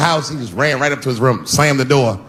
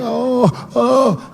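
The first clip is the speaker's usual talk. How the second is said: high wailing sob, hammed-up; mock crying son; 11 semitones higher than usual. Son brought in to the principal, mock crying. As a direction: whimper like a scared little boy.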